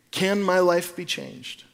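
ordinary talk

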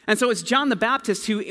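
ordinary talk, nothing marked

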